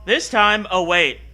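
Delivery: usual speaking voice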